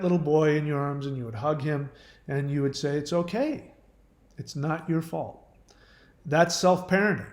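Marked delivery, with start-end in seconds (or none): 3.0-5.5 soft, tender reassurance; acted soothing parent, softly spoken; comforting. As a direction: gently console a hurt little child. Acting out hugging and comforting child.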